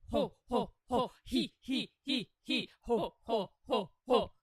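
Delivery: loud wobbling vocal-warmup chant; vibrated voice. Real, not acted. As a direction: let the vibration shake each syllable.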